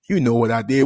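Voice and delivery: ordinary talk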